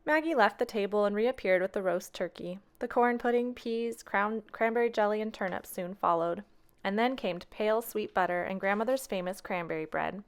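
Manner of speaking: ordinary delivery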